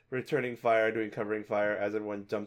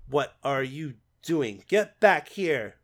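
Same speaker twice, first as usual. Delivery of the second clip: calling out, louder and higher; yelling; 3 semitones higher, 6 dB louder, slower than usual. Acted. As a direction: shout after him to come back. Character yelling after fleeing ally.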